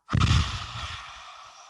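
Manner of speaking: forceful breathy roar exhale; lion's breath exhale. Shown as a demonstration. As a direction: huff out hard like a lion. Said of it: "This is lion's breath".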